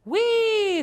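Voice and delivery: very high loud whee; gleeful whee. A narrator's reading. Amelia Bedelia having fun, gleeful squeal. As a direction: ride the sound up with glee.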